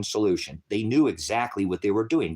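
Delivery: usual speaking voice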